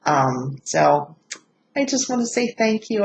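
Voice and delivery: ordinary talk, nothing marked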